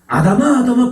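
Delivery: a high, loud call of the name, twice. Retold or imitated. God calling out to Adam in the garden, loud and high.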